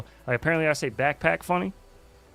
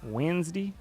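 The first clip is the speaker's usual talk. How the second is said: "Wednesday" as "Wenzdee" again, rising at the end; mock Baltimore "Wednesday" with a lifted finish; Baltimore accent; 5 semitones higher, 5 dB quieter than usual. Shown as a demonstration. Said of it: "Baltimore accent".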